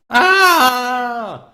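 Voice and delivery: loud, high drawn-out "Ah!"; wailing barbarian scream; pained scream. Acted. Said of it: "Hector is like".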